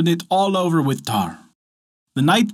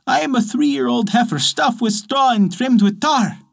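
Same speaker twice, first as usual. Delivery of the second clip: a simple, sing-song ox answering the bear; straw ox voice; 8 semitones higher than usual. Acted. Ox answers the wolf.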